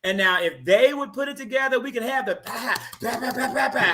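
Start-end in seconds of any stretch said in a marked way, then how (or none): 2.4-3.7 vocal drum beat "pa pa pa"; beatbox the drum line. Shown as a demonstration. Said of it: "drum line".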